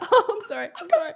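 high, laughing mock sobs; mock crying while laughing; laughing fake cry.

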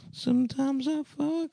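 high drawn-out. Acted. Sung-style joke line.

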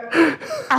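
sharp high gasp of surprise; startled gasp. Retold or imitated. Startled gasp on being recognised in the pub. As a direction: catch the breath, caught off guard.

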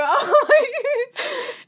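loud high-pitched laugh; high laughter. Real, not acted. Laughing together.

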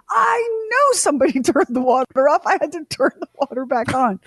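high, cracking mock yell with a laugh; exasperated outburst. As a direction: blurt it out like a fed-up husband. Husband's exasperated reply retold.

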